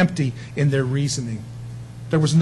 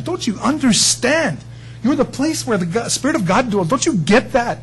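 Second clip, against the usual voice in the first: impassioned plea; 7 semitones higher than usual. Retold or imitated. Paul pleading, said three times.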